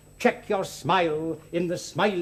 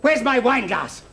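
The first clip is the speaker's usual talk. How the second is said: high, loud confrontational shout; furious demand; 4 semitones higher, 5 dB louder than usual. Acted. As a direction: pounce on him with an angry yell. Bursts in still furious.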